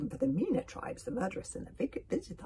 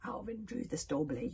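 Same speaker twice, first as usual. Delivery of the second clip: ordinary delivery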